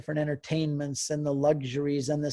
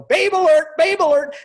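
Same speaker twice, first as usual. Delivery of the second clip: high, loud sing-song warning call; loud playful alarm call; 12 semitones higher, 11 dB louder than usual. Retold or imitated. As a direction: shout it out like teenage boys warning each other. Boys calling out a warning.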